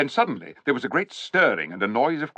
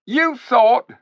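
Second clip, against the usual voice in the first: high, loud sneering ape voice; sly ape; 14 semitones higher, 8 dB louder than usual. A narrator's reading. Ape sneering at the clever dwarfs, high and loud. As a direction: talk down to the dwarfs like a smug ape.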